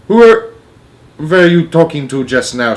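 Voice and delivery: high, loud sharp snap; snapped. A narrator's reading. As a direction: snap the question at her. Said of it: "snapped the Grand High Witch".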